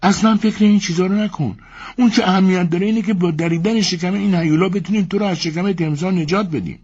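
wife cutting off her husband, high and urgent; Elena cutting in. Acted. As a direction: interrupt him, eager to cut him free. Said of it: "وسط صحبت او دوید و گفت".

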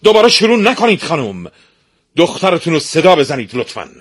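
the prince shouts at the stepmother to call her daughter; shouting prince. Acted. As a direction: yell to stop her talking. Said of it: "شاهزاده فریاد زد".

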